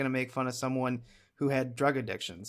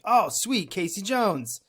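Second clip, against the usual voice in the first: higher-pitched, mildly thrilled recalled reaction; excited kid; 6 semitones higher, slower than usual. Retold or imitated. Remembering seeing Casey Jones return as a kid, excited. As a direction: imitate your delighted younger self. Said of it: "as a kid being like".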